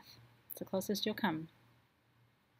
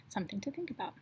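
whisper; 6 dB quieter, faster than usual. Real, not acted. Reflective aside after ritual talk.

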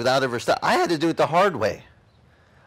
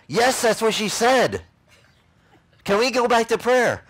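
louder, higher, pleading to get back to prayer; exasperated; 9 semitones higher, 5 dB louder than usual. Retold or imitated. Under the coffee table, begging the room to resume prayer, exasperated.

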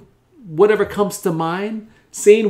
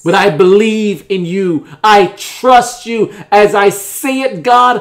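loud preaching plea, building higher; impassioned prayer; 3 semitones higher, 7 dB louder, slower than usual. Acted. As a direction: build to a high, loud, drawn-out cry of belief.

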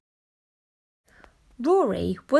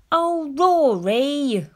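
slow, louder grumble of a fed-up dad; grumbling dad; 5 semitones higher, 5 dB louder, much slower than usual. Acted. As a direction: drag it out, annoyed and disappointed. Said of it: "grumbled Dad".

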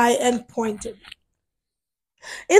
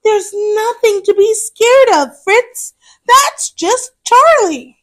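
reassuring; 7 semitones higher, 4 dB louder than usual. Acted. Big sister reassuring brother.

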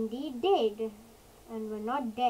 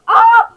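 sudden loud, high cry; startled scream; 10 semitones higher, 23 dB louder than usual. Real, not acted. Player seeing the meteor about to crack, startled scream. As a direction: cry out in shock.

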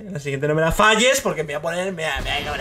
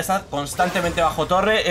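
normal talking voice

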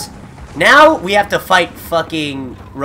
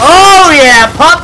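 loud, high, drawn-out cheer; excited cheer; 11 semitones higher, 11 dB louder, much slower than usual. Real, not acted. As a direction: celebrate the hit at full voice. Cheering a hit in game.

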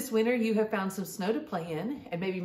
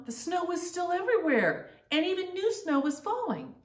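delighted; 9 semitones higher than usual. Acted. Joyful turn in the story.

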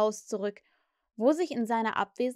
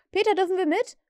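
high, loud begging plea; 8 semitones higher, 5 dB louder, faster than usual. A narrator's reading. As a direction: beg him eagerly. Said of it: "beknieten sie ihn alle".

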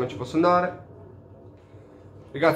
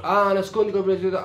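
higher, rising to a louder exclamation; sudden realization; 6 semitones higher, 5 dB louder, faster than usual. Real, not acted. Player reading the hidden objective to viewers, sudden realization. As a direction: it dawns on you mid-read.

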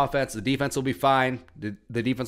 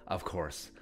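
"of course" dropped lower and much softer, under the breath; muttered aside; 7 semitones lower, 13 dB quieter than usual. Acted. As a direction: mumble "of course" low to yourself. Repeating "of course" in varied ways.

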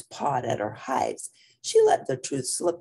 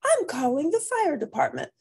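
high mama voice; 11 semitones higher than usual. Acted.

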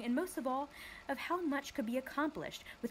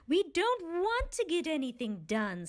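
angry snap; 7 semitones higher, 4 dB louder than usual. Acted. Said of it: "snapped another angrily".

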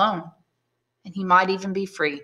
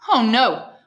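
loud, higher-pitched protest; crying out; 5 semitones higher, 7 dB louder than usual. A narrator's reading. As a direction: cry out in quick denial. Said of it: "cried Dantes".

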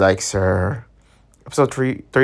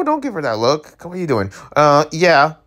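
normal talking voice

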